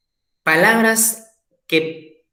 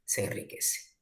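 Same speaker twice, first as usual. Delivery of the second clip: whispered echo of "Se enriquece"; soft whispered repeat; 16 dB quieter than usual. Shown as a demonstration. Teacher repeats, second echoed.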